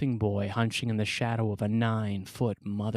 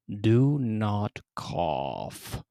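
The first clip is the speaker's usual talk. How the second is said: stern mother; much slower than usual. Acted. Severe mother slowly warning her son not to cough. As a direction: draw each word out like a looming mother.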